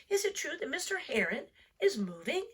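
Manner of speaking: put-on a young turtle asking his teacher; Franklin's child voice. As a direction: ask it up high like a curious child.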